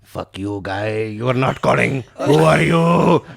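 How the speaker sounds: angry scammer. Retold or imitated. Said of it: "They're like".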